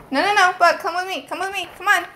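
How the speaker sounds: high, coaxing voice for a pet; pet talk. Real, not acted. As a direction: coax the animal along. Coaxing her pet away.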